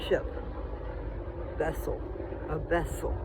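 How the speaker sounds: toothless mumble, lips over gums; words slurred with no teeth; talking without teeth. Real, not acted. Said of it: "God, I have no teeth".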